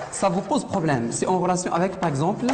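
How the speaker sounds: ordinary talk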